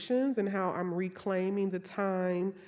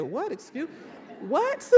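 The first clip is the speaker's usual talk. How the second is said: stunned, high-pitched what; mock shocked what; shocked disbelief; 7 semitones higher than usual. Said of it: "I said".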